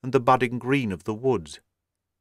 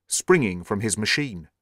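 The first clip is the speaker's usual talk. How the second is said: ordinary delivery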